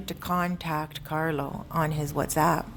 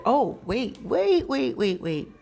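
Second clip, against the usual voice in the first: higher, hurried wait wait; excited stop; 6 semitones higher, slower than usual. Real, not acted. Stopping her own story to rewind it: higher excited wait. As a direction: cut yourself off to rewind the story.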